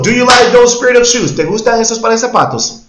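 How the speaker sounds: animated teacher demo; excited teacher model. Shown as a demonstration. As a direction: sell the example with energy. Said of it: "teacher models question excitedly".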